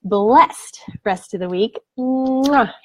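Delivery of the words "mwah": short "mwah" kiss sound; smacked kiss with a falling "ah"; blown kiss. Real, not acted. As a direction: blow a quick kiss goodbye. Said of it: "Angel kisses to each of you".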